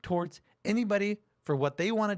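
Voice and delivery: high, calm imitation of Shapiro; lightly voiced pundit defending himself; Ben Shapiro quote. Retelling Shapiro's words on panel.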